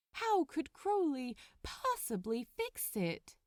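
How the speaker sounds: plain reading voice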